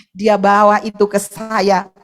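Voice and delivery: ordinary talk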